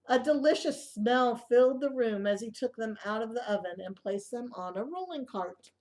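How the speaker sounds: ordinary delivery, nothing marked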